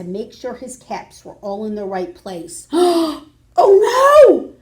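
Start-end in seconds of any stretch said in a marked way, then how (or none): 2.7-4.5 gasping loud cry of alarm; shocked gasp. Acted. Peddler finding his caps gone, loud gasping alarm.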